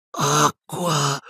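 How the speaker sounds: high, strained croaking plea; weak croak. A narrator's reading. Dying professor asking for a drink, croaking. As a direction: croak it out in agony. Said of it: "gracchiò Silente".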